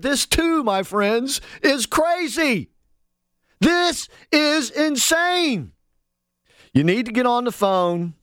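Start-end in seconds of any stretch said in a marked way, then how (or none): 0.0-5.7 exasperated. Real, not acted. Exasperated radio rant.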